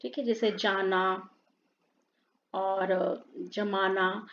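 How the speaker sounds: जाना and जमाना said with a plain j as in jam, no nukta; teacher demonstrating the plain j in जाना and जमाना; plain j without nukta. Shown as a demonstration. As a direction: give जमाना a clear Hindi j, slow and careful. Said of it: "जो जे है, वो नुक्ता रहित".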